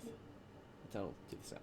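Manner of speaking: faint, slow, breathy near-whisper; barely audible, from across the room; very quiet. Real, not acted. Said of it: "your mic is real quiet for me".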